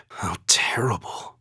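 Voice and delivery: slow, hushed whisper; soft slow whisper of shock. Acted. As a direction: breathe it in dismay. Shocked at sight.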